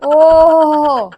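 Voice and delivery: long, loud mocking "ઓહો"; sarcastic wife mocking her husband's kindness; sarcastic drawn-out oho. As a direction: stretch it out in loud disbelief. Wife mocks husband's claim.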